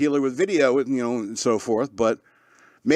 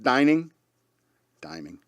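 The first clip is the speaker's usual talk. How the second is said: mock echo; 2 semitones lower, 3 dB quieter than usual. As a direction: repeat dining, fading away like an echo. Echoing fine dining jokingly.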